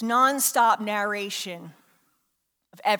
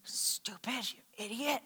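very soft self-reproach; inner critic; 12 dB quieter than usual. Acted. Inner voice calling yourself stupid, hushed self-scolding. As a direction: scold yourself almost inaudibly. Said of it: "does that voice call yourself stupid".

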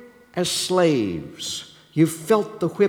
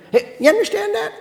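ordinary delivery

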